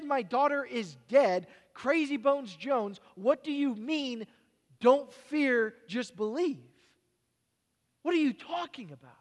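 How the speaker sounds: a grieving father scoffing at Jesus; disbelieving father. Acted. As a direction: throw his words back in disbelief.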